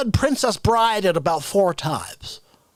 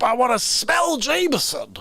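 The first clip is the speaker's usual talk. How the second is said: ordinary talk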